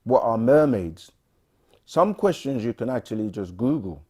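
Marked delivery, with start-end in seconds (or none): none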